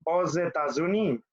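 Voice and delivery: "Aux États-Unis" joined by a z; liaison with Z. Shown as a demonstration. Teacher gives another vowel example, pronounced with z liaison. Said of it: "suena como z".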